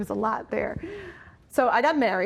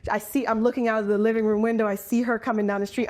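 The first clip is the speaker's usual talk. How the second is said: ordinary talk, nothing marked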